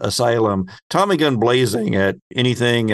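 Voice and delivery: ordinary talk, nothing marked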